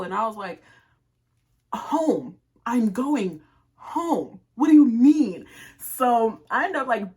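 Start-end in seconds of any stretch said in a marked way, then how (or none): 1.7-5.5 loud, pitched-up retort; indignant. Retold or imitated. Answering him as she leaves, loudly indignant. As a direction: fire it back loudly, offended.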